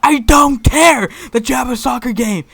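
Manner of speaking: loud, drawn-out angry shout; fed-up substitute blowing up; pissed off. Retold or imitated. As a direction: yell it slowly, high and exasperated. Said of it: "Sub's getting fucking pissed".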